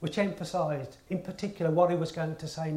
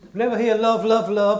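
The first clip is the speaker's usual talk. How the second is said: louder, pressing each love hard; repeating "love, love, love" louder so it lands; emphatic repeat; 3 semitones higher, 8 dB louder than usual. Shown as a demonstration. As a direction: drive it home to the congregation.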